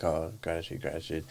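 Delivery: soft, dismissive mock repetition. Mocking people who trumpet gratitude, soft and dismissive.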